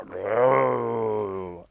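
rolled growl; wolf growl. Acted. Fenris growling at Röskva, rolled wolf growl.

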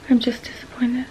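whispered, drained and defeated; a tired whisper of disappointment; real whisper. Real, not acted. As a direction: let it fall away into a whisper. Repeating her disappointment, whispered.

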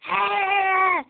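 short high shout of a distant crowd; a loud imitated scream from the crowd; mock crowd scream. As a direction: yell it like the concertgoers far off. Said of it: "and you could hear".

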